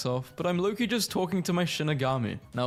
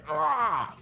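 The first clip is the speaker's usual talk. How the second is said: short high cry of pain, hammed-up; mock anime grunt; 8 semitones higher than usual. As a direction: yelp like a hit anime hero. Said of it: "Anime hero".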